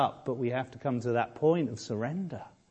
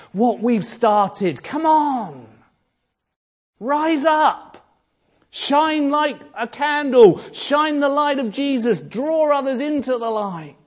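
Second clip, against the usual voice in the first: high, loud, ringing pulpit delivery; full-force preaching; 12 semitones higher, 8 dB louder than usual. Acted. Preacher lifting the congregation with Bernard's example, in a loud, high ringing voice. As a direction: preach it out to the whole church. Rousing exhortation to church.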